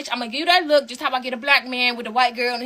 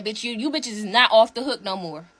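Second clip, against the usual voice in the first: ordinary talk, nothing marked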